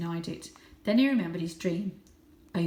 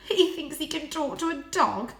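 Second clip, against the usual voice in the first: laughing; 8 semitones higher, 5 dB louder than usual. A narrator's reading. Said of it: "laughed out loud".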